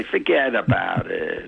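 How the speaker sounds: slow, dismissive New York accent on "Forget about it"; "Forget about it" with a thick New York accent, cutting in. Shown as a demonstration. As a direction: wave it off like a New Yorker, drawn out. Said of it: "I just say".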